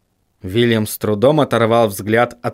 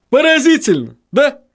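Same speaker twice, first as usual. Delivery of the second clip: smirking; 14 semitones higher, 3 dB louder than usual. A narrator's reading. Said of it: "ухмыльнулся господин Винтлер".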